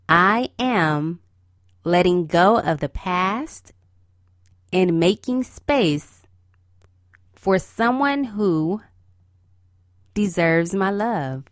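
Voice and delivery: slow, phrase by phrase, with pauses; slow affirmation. Shown as a demonstration. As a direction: say each phrase slowly for them to repeat. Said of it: "Repeat this to yourself".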